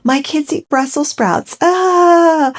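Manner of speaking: high, squealing mock of a proud mum; mock-delighted squeal ending in a high cry; mocking Megan. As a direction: gush it like a fake-perfect mum. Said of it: "she made an effort".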